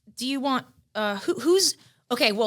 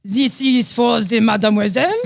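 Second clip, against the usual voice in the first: louder French waiter voice; French accent; 7 dB louder than usual. Acted. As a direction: play a snooty French waiter. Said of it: "French accent".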